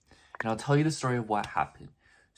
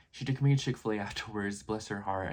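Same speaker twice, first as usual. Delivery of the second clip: usual speaking voice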